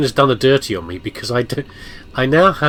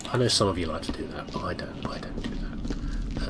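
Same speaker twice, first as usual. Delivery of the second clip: whispered aside; 4 semitones lower, 10 dB quieter than usual. Real, not acted. Confiding aside to viewers.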